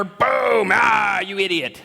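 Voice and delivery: mock shout of exasperation; mock outburst. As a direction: play an exaggerated hammer-blow outburst. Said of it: "He didn't come down with him with a hammer".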